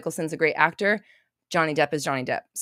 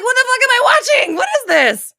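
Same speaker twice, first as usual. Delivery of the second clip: very high, loud yell of disbelief; outraged shout; 10 dB louder, faster than usual. Acted. Viewer appalled by an imagined film killing kids, shouting in outrage. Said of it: "you're like".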